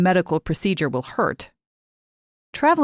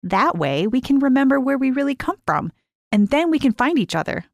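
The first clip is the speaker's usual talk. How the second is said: put-on higher girl's voice, eager and conspiratorial; young girl; 8 semitones higher than usual. A narrator's reading. Lise showing Mary how to hide the pill, eager. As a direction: coax a friend into a secret plan.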